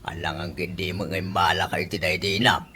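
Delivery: soft breathy whisper, low; whispered aside. Acted. Father whispers a teasing aside to his daughter. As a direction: lean in and whisper it.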